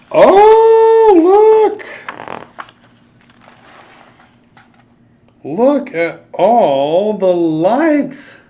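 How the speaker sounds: very high, slow amazed oh; wonder. Acted. Storyteller showing the night light: slow, high amazement. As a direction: marvel at the lights, drawn out. Awestruck at lit lamp.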